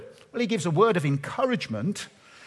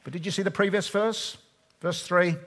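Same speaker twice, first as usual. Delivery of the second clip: normal talking voice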